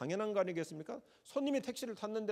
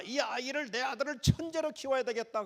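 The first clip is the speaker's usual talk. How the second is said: put-on eager young parent; 7 semitones higher than usual. Proud parent resolving.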